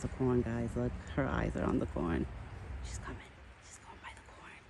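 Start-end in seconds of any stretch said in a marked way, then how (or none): 2.8-4.6 hushed whisper so the deer stays calm. Real, not acted. Whispering to viewers so she won't get scared. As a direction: breathe the words, no voice. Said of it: "I gotta whisper".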